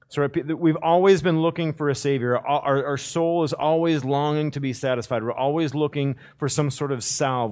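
loud, high, urgent sermon build; fervent preaching. Acted. Preacher urging the congregation, fervent and loud. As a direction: press the longing home to the congregation.

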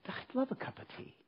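a hushed, half-laughing acceptance; stirred whisper. Retold or imitated. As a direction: whisper it like a surprising inner yes. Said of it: "something inside, you know, stirred".

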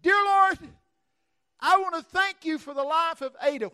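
high ringing shouted prayer; loud prayer. Retold or imitated. Preacher praying over dead Miss Ada, high loud cry. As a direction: cry it out to heaven. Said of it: "I said".

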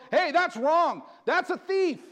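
high, loud warning cry; barking alarm. Retold or imitated. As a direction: shout it like a watchdog barking. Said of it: "not barking and going".

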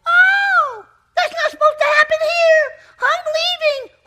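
very high, loud shouted squeal of a fleeing churchgoer; hammed-up shriek of someone bolting from church; mock churchgoer panic. Preacher mocking churchgoers who flee deliverance, squealing loud and high. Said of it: "this is where churches go".

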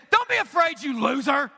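imitated contemptuous scolding; mock angry God. Shown as a demonstration. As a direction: act out a harsh God berating someone. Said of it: "That God is angry all the time".

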